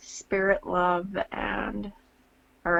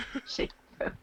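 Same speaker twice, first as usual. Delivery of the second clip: laughing whisper; 8 dB quieter than usual. Real, not acted.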